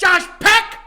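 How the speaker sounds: loud, high shouted impression; Josh Peck. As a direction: yell it high, in a put-on voice. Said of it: "my Josh Peck impression".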